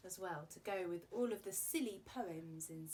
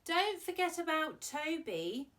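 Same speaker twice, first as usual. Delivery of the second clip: cheerful Toby; 8 semitones higher, 9 dB louder than usual. Acted. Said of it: "and said".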